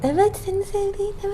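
high, soft cooing to a pet; gentle coaxing. Real, not acted. Owner coaxing cat to eat. (yumuşak, tiz sesle kandırma)

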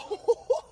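short high-pitched nervous laugh; squeaky startled giggle. Real, not acted. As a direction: laugh off the fright. Nervous reaction in the car wash.

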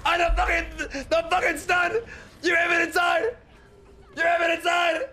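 high, furious shouting; angry shouting. Real, not acted. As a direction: blow up at the team. Raging at missed stun.

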